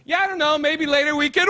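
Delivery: put-on high, breezy girl chatting; girl chatting before she walks in; casual girl voice. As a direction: play the unsuspecting girl. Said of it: "you hear one girl just go".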